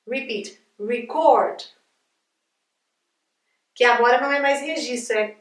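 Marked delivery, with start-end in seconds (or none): 0.8-1.6 verb "record", stressed ri-CORD; stress on second syllable. Shown as a demonstration. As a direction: model "record" for repetition, -CORD strong. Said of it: "essa é a sílaba mais forte".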